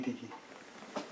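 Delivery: ordinary delivery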